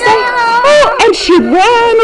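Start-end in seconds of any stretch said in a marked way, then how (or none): 0.0-1.0 hammed-up frightened cry, much higher; mock fright of Goldilocks, a little shout; scared cry. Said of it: "And she got so scared".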